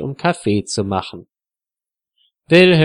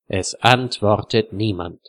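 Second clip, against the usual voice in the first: plain reading voice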